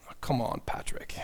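a quiet mock scolding under the breath; hushed playful "come on, Patrick"; teasing aside. Real, not acted. Aside teasing Saint Patrick's analogy.

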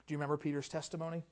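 ordinary talk, nothing marked

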